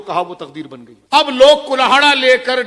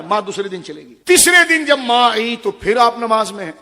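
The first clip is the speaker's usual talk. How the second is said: ordinary delivery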